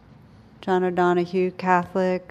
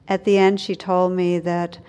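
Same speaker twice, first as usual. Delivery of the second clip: normal talking voice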